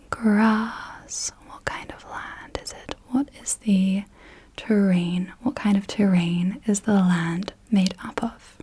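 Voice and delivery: normal talking voice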